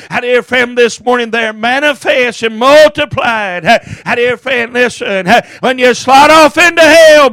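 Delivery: ordinary talk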